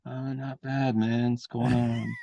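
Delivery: a hint of a guy three joints in, easy and soft; stoned Roddy Piper. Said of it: "he's always felt like he was three joints in".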